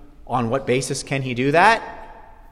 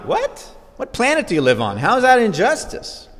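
incredulous; 8 semitones higher than usual. Acted. Preacher mocks the objection.

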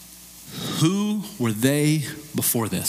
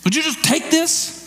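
much higher, exasperated pleading rebuke; getting angry; 13 semitones higher than usual. Pretend. Pastor frustrated at a friend refusing debt payment, exasperated high rebuke. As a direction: shout at someone refusing a gift. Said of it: "I'm gonna get angry with you".

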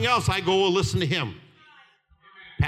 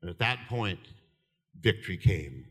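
ordinary talk

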